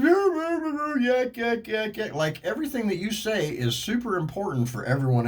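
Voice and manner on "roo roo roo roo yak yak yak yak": high, loud mocking yak yak; mock yakking noise. Mocking a driver who talks on speakerphone, high yakking. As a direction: imitate someone's pointless phone chatter. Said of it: "I said".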